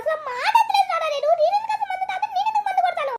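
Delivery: high, whining protest with a laugh; whiny grandson. Acted. Grandson protests to grandma in a high whine. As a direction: plead innocence like a sulky boy. Son denies drinking to father.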